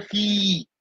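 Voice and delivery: "fi" with the ee held long and level; long vowel. Shown as a demonstration. Teacher showing the class a long voyelle, "fi" drawn out. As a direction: stretch the vowel of "fi". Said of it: "C'est une longue voyelle".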